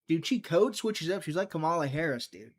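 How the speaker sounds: ordinary talk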